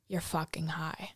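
hissed low accusation, barely voiced; mom's accusing whisper. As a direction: whisper it like a damning verdict. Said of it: "and she goes".

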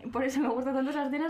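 fast words through laughter; giggling confession to a friend; laughing. Real, not acted. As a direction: rush it out while cracking up. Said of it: "Casi llorando".

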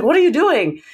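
high-pitched mock disbelief; incredulous question. Manager watching rep type the wrong thing, retold incredulously. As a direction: replay your bewildered reaction, pitched well up.

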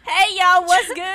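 loud, high New York greeting; New York accent. Acted. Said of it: "New York accent comes out so hard".

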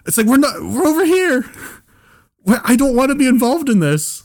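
high-pitched mock pleading; distressed protest. Bystander not wanting to be involved, high pleading protest. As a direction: whine upward to stay out of it.

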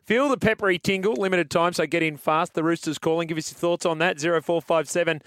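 upbeat presenter patter; radio host sign-off. Acted. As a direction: punch out the sponsor read and throw to the news. Radio segment sign-off and sponsor read.

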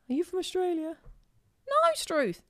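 high, exaggerated Australian accent. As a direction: ham up the Australian twang, pitched high. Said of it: "the stereotypical".

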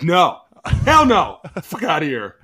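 loud, high brush-off; mock dismissive. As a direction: shoo them away, loud and high. Rejecting tight ends.

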